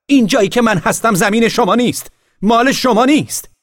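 very high, loud furious yell; shouting. A narrator's reading. Boy in the tree yelling this isn't her land. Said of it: "فریاد زد".

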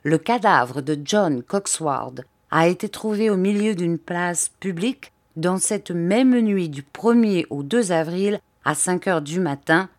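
ordinary delivery, nothing marked

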